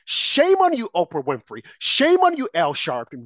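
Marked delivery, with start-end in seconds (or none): 0.1-0.9 indignant rebuke. Real, not acted. Caller rebuking celebrities, high outraged scolding. Denouncing public figures one by one. 1.8-2.5 indignant rebuke. Real, not acted. Caller rebuking celebrities, high outraged scolding. Denouncing public figures one by one.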